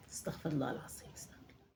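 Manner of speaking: soft unvoiced murmur asking forgiveness; whispered prayer. Old mother begging forgiveness after her lie, whispered prayer. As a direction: breathe the prayer, barely audible.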